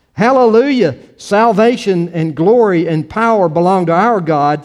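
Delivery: loud, high cry of praise; loud acclamation. A narrator's reading. Great multitude in heaven crying out, read as loud praise. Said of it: "the loud voice of a great multitude".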